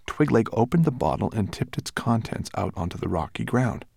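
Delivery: plain reading voice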